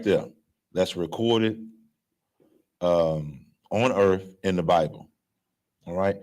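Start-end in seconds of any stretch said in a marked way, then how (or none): none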